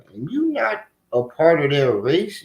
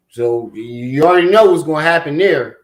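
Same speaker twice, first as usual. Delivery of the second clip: ordinary talk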